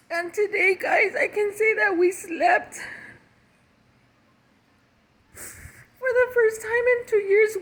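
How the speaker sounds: tears of joy after a sleepless night; crying. Real, not acted. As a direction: cry through the words, overwhelmed. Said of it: "these are tears of joy today".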